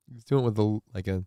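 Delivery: ordinary delivery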